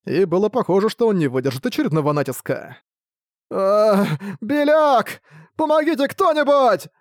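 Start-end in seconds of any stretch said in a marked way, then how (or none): 3.5-6.9 high, wailing plea for help; pitiful sobbing cry. Acted. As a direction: sob out a call for help. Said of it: "Жалобно зарыдал Буксил".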